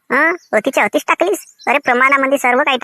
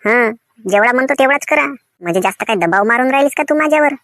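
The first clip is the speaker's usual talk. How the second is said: usual speaking voice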